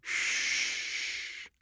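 long, soft shushing; pleading hush. A narrator's reading. Man pleading with his dying love, softly shushing. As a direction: hush her gently, all breath. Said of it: "he pleaded".

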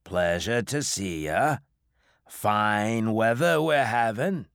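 slow New England drawl. A narrator's reading. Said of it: "in a slow New England drawl".

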